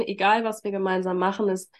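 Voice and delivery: usual speaking voice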